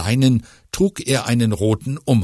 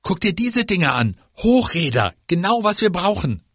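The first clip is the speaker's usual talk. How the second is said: hoch, eifriger Jungenton; aufgeregter Junge; 8 semitones higher than usual. Acted. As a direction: ein Kind treibt die Freundin an. Fiete als Kinderfigur. (aufgeregter Jungenton)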